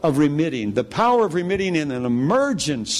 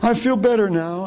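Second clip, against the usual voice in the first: injured woman; 9 semitones higher than usual. Retold or imitated. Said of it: "She says".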